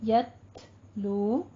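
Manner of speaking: "यतलू" slow with a puff of breath after t; drawn-out "यतलू", whispery t release; hams on ta. Shown as a demonstration. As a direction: release a light breath on the t of "यतलू", then long oo. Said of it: "हल्की सी सांस की आवाज".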